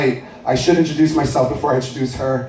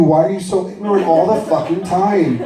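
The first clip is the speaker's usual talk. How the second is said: ordinary talk, nothing marked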